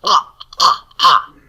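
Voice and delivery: ah with a tight constriction in the throat, like gagging; throat ayin sound. Shown as a demonstration. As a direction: make the ah from the back of the throat. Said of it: "to produce the ah sound".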